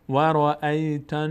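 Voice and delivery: رأیتن with the nn held through the nose; slow رأیتن with a nasal hum on nn; nasal ghunna. Shown as a demonstration. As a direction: hold the doubled n of رأیتن nasally.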